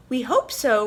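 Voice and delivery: earnestly hopeful little squirrel; sincere squirrel. A narrator's reading. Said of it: "replied a most sincere Whirly Squirrel".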